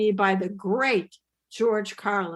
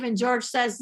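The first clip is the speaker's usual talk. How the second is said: ordinary talk, nothing marked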